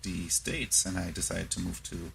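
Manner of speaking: ordinary talk, nothing marked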